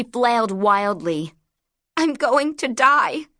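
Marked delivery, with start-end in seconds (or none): 2.0-3.3 high panicked scream; terrified scream. Acted. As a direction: scream as if falling to death. Falling, terrified.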